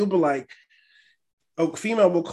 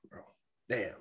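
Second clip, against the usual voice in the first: a low, much softer aside to himself; muttered under breath; 8 semitones lower, 16 dB quieter than usual. Retold or imitated. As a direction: mutter it as if nobody should hear.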